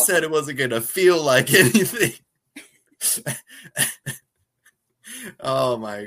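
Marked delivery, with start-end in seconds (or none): 0.0-2.1 higher, louder retold complaint breaking into laughter; laughing mock protest. Man sent flying complains, laughing mock protest. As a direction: imitate his protest through laughs. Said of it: "He's like".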